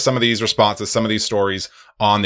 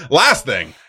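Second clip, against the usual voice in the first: laughing; 14 semitones higher, 4 dB louder, slower than usual. Real, not acted. Laughing while announcing contest.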